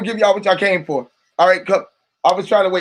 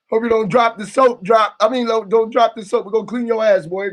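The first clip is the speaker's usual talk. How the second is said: ordinary talk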